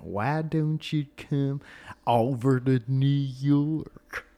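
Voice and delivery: put-on slow, drawn-out New York accent. Retrying the New Yorker fan line, in a slow New York accent. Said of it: "I can't do a New York accent".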